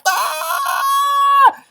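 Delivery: high shouted squawk; chicken squawk. A narrator's reading. Chicken bursts out yelling, high squawk. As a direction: blurt a startled squawk. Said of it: "he's like".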